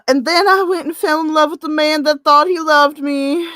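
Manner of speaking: lightly voiced romance heroine, breathless and grateful; Tia's voice. Reads Tia's dialogue aloud.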